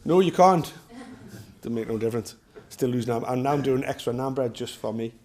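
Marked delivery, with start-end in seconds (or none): 0.1-0.7 louder, higher firm "No, you can't"; mildly raised dad's no; firm refusal. Retold or imitated. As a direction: shut the kids down. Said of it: "It's like".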